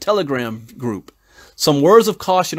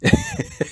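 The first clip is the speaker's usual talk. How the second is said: high, light burst of laughter. Real, not acted. Man picturing an international incident, amused high laugh. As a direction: laugh at your own absurd scenario. Laughs at his own imagined disaster.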